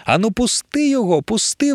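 high frantic shriek. Acted. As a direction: shriek at the monster to let go. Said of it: "верещала Емілія".

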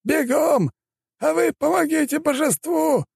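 high-pitched, urgent yelled orders; frantic, strained calling out; elder's shout. A narrator's reading. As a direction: yell commands across a distance. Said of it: "закричал старейшина".

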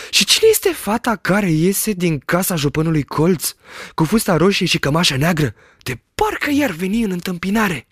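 high, lively shepherd voice, delighted; excited shepherd. A narrator's reading. Shepherd describes the village he sees, high and thrilled. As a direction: rattle off the sights in wonder.

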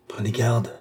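usual speaking voice

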